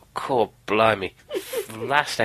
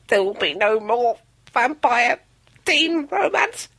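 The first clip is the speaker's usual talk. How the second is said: dramatic declaration. Acted. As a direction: declare it like a decree. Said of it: "You sound".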